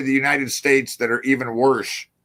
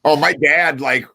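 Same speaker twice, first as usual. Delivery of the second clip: usual speaking voice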